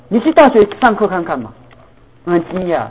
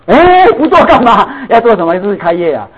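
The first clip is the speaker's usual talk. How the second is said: startled father; 5 semitones higher, 5 dB louder than usual. Retold or imitated. Father reacting to son's news.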